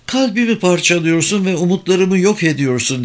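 a mother telling her son he breaks her heart, mournful. A narrator's reading. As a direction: plead through heartbreak. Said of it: "dedi Umayma hazin bir sesle".

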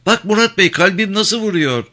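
high, flustered woman's voice; startled woman, voice pitched well up; startled wife. Acted. Startled woman scolds child, shows heart.

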